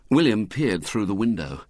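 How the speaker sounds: usual speaking voice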